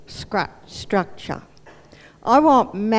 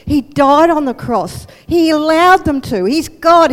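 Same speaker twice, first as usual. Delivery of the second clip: louder, rising fervor; fervent proclamation; 4 semitones higher, 7 dB louder than usual. Acted. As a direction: proclaim it with conviction. Paul proclaims Jesus' sacrifice.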